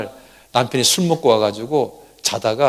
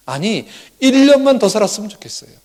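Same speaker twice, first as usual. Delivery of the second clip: acted plea, higher and louder; raised, urgent wish in a mother's role; earnest plea; 8 semitones higher, 5 dB louder than usual. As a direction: plead harder as the desperate mother. Voicing the mother's greater wish.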